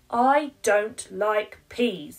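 slow, loud flat refusal; stubborn child. Acted. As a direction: dig your heels in like a stubborn little girl.

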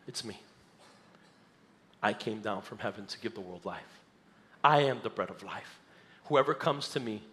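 low, hushed and deliberate, with long pauses; Jesus. Acted. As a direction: say it clearly and quietly, letting each phrase land. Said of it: "says it clearly".

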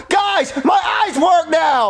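high, slow, overjoyed announcement; gasp, then cry out the miracle; amazed shout. Acted. Eyes miraculously healed.

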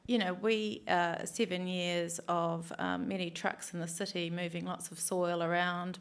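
ordinary delivery

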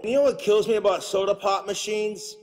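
usual speaking voice

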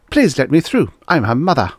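lightly voiced insistent woman; urgent mother. A narrator's reading. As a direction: push through the crowd with it. Mother pushing through crowd.

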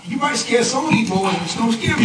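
high, defiant challenge. Real, not acted. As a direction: stand up to him, defiant. Defiant reply in board quarrel.

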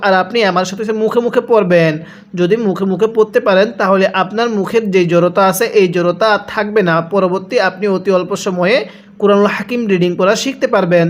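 ordinary talk

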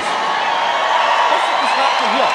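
loud, slow, high bellow; hammed-up outraged yell; mock outrage. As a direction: roar it as if the world has gone mad. Mock panic at tourists arriving.

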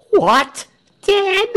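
very high, loud, rising "Dead?"; shocked disbelief. A narrator's reading. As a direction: exclaim it as a stunned question. Crowd shocked by the news.

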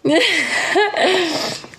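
high burst of laughter; real laughter. Real, not acted. Streamer amused by their own pituco accent, real high laugh. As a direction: crack up at your own impression.

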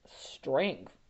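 ordinary delivery, nothing marked

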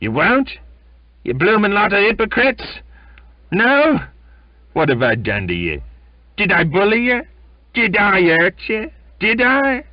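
high, loud, raging shout at the crew; furious sneering tirade. Acted. Sailor rages at the crew who refuse his drink, loud and furious. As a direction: spit contempt at the whole crew.

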